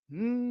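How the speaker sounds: soft quiet hum; car hum. Shown as a demonstration.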